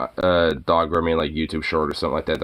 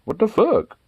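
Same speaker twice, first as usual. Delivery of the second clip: much higher, offended dog voice; voicing the dog; slower than usual. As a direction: squeak out the dog's outrage. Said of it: "looking for either, like".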